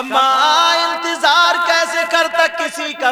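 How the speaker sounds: high, loud, slow wailing plea; anguished cry. Acted. Son crying out to his mother, slow, high and loud.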